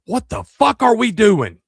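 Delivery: loud high slow outraged yell; kid yelling. Retold or imitated. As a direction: shout it like a kid in class.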